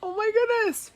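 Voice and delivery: very high, delighted squeal of surprise; amazed gasp. Retold or imitated. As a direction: shriek with joy at the mirror. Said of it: "And I'm like".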